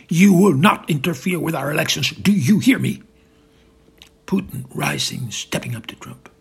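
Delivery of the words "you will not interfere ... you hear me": loud, emphatic warning. Acted. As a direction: lay down the law at volume. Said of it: "Trump loudly".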